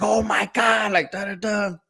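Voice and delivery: high-pitched imitation of an appalled mom; pissed mom. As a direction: mimic a mother's outburst, pitched well up. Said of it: "So she was pissed".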